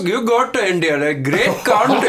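put-on Indian English; Indian accent. Shown as a demonstration. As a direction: do a comic, complaining Indian accent. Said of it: "Let's hear Indian".

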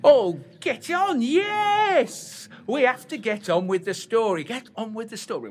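very high, loud, fast squeal; excited shriek. Acted. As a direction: burst into a giddy squeal. Sudden theatrical outburst.

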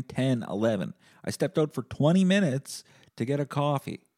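mock-exasperated self-defence; acted indignant rebuttal. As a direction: argue your case to the nurse, pitch climbing on each point.

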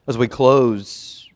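drawn-out emphatic announcement; loud, slow. Real, not acted. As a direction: announce the close slowly and loud. Slow loud sermon turn.

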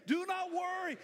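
loud high shout on not; shouted declaration. Shown as a demonstration. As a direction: shout it to the congregation. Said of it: "Say it with me again".

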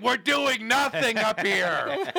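high, loud exasperated outburst; mom freaking out. Retold or imitated. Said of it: "She'd freak".

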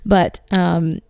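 dry, raspy throat; hoarse from the dry North Dakota air. Real, not acted. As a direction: talk through a dry throat. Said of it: "Disregard my raspy voice".

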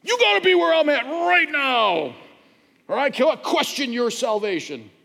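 high, loud imitated yelling; mock yell. Pastor imitating the man yelling at him about theology, mock yell. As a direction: bark it out like an impatient know-it-all. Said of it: "he just starts yelling".

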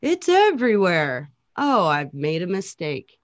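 slow, high, dismayed God realizing a mistake. Retold or imitated. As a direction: drawl it out like a stunned creator. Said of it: "he was like".

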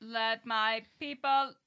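slow robot chant; robotic, long-held final word; robot voice of God. Acted. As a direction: drag it out like a droning machine god. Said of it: "it's like a robot voice".

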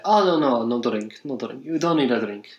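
imitated Italian accent, waving off an offer; terrible Italian accent. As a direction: modest refusal in broken Italian-accented English. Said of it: "I'm doing a terrible Italian accent".

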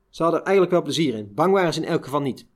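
ordinary delivery, nothing marked

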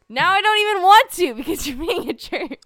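high, loud whine through laughs; laughing whine. Real, not acted. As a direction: pout while laughing. Laughing pout at co-host.

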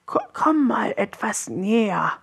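high, faltering creature voice; wheezing troll frog. A narrator's reading. The sick frog calls Willi closer, wheezing. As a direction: stammer weakly as an ailing frog. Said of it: "Der Trollfrosch hustete und keuchte".

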